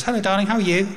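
a bright, casual hello down the line; wife's voice. Retold or imitated.

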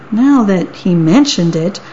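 usual speaking voice

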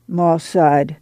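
ordinary delivery, nothing marked

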